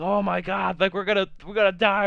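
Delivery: panicky, high and loud; a scared kid sure he'll die; freaking out. Retold or imitated. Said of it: "so I'm freaking out".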